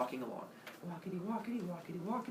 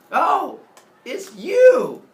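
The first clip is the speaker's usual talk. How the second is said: much higher, much louder cry; excited greeting; 9 semitones higher, 17 dB louder, much slower than usual. Acted. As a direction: squeal at the door. Semele opens door to Zeus.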